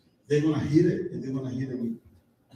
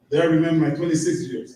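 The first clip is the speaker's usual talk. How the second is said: usual speaking voice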